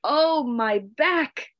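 high, slow groan of pain; narrated groan, long and rising high. A narrator's reading. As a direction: stretch it into a high, aching cry. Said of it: "She groaned".

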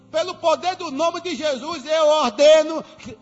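loud, high command, building to a shout; commanding decree. Acted. Pastor decreeing to the fridge, loud and rising. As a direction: order the fridge to fill in Jesus' name.

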